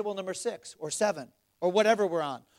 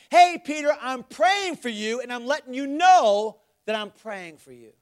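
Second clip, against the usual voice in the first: loud, raised preacher's voice; forceful preaching; 5 semitones higher, 7 dB louder, slower than usual. Acted. Preacher voicing the Lord's words to Peter, forceful and urgent. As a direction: drive it home to the congregation.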